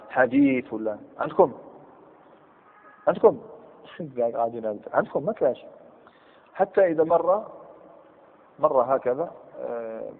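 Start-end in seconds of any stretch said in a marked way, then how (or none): none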